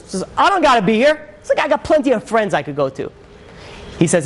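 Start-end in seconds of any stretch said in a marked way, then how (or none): 0.4-3.1 high-pitched talking back; retold indignant retort, pitched up; defiant son. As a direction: act out a teenager defying his father. Son talks back to father.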